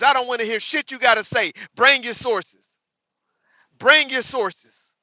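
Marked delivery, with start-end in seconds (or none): 1.8-4.9 "Bring your sources" slow and spaced out, each word weighed; slow demand. Real, not acted. Repeating demand slowly.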